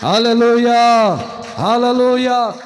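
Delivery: slow, loud, drawn-out hallelujah. Real, not acted. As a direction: lead the church in praise. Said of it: "స్తోత్రం అని చెప్పండి". (నెమ్మదిగా బిగ్గరగా హల్లెలూయా)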